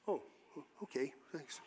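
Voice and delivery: low, very soft crestfallen reply; acted deflation. Guest told he's unwelcome at supper, deflated. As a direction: shrink back, as if just turned away.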